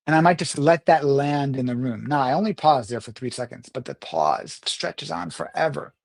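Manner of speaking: ordinary delivery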